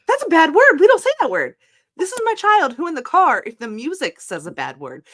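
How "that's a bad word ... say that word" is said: high, loud parental correction; scolding parent. Retold or imitated. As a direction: lay down the rule firmly to the kid. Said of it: "And I was like".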